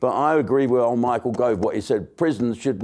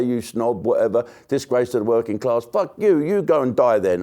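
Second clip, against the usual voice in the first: normal talking voice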